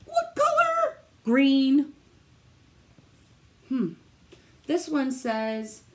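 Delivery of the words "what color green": high, loud teasing quiz; excited question. Acted. Teacher quizzing the kids on the color, high and excited. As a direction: pitch the question up high and call out the answer. Building suspense for answer.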